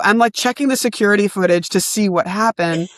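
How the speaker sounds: normal talking voice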